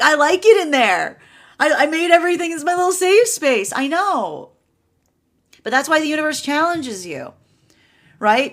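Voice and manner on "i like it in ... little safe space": cozy homebody. Retold or imitated. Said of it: "You're like".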